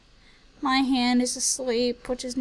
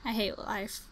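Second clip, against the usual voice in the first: ordinary talk, nothing marked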